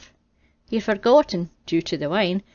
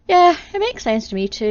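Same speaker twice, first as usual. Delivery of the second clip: high, loud agreement; emphatic; 9 semitones higher, 7 dB louder than usual. Acted. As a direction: burst out agreeing.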